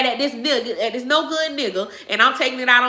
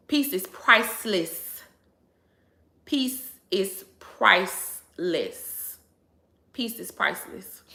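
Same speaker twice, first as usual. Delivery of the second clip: slow soft mantra; 3 semitones lower, 4 dB quieter, much slower than usual. Real, not acted. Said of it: "slow repeated mantra".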